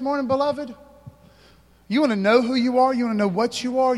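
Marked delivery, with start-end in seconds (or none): 1.9-4.0 rising, louder preacher call; fervent pulpit preaching, a touch louder; preaching at full force. Acted. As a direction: build the crowd up. Preaching builds up.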